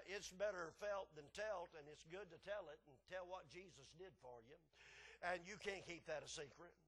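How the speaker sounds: usual speaking voice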